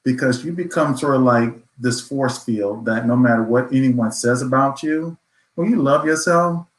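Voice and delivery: ordinary talk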